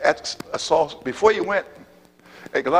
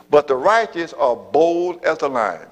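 slow, louder proclamation of scripture; scripture proclaimed; 2 semitones higher, 4 dB louder, slower than usual. Acted. Preacher reading Proverbs slowly and louder to the congregation. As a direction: preach the verse slowly with force. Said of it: "Proverbs chapter twenty-eight".

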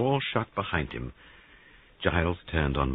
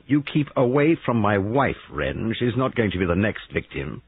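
louder, firm husband warning a rival; angry warning; 5 semitones higher, 5 dB louder than usual. Acted. Giles ordering Wren away from his wife, angry. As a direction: warn him off forcefully.

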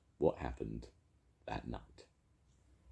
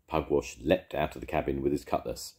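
ordinary delivery

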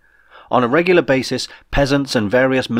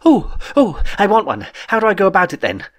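gushing excitement; 9 semitones higher than usual. Acted. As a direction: bounce with eager glee like a child. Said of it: "he gushed".

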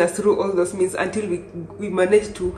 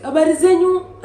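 lady's greeting; 10 semitones higher, 9 dB louder than usual. Retold or imitated. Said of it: "another lady was like".

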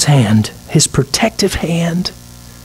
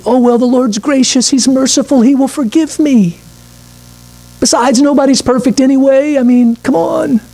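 higher-pitched, casual shrug of an excuse; breezy presumption; 7 semitones higher than usual. Acted. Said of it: "this attitude".

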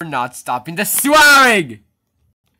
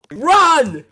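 urgent cry; 10 semitones higher, 3 dB louder than usual. Acted. Warning to flee.

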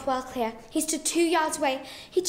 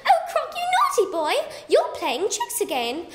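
much higher scolding call; lady calls to her crocodile, high scolding; crying out; 8 semitones higher than usual. Acted. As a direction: chide the naughty pet from afar, high. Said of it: "she cried".

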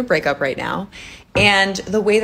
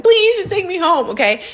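mock crying plea; 13 semitones higher, 6 dB louder than usual. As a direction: imitate a tearful, freaked-out plea. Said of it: "I was just freaking out, crying".

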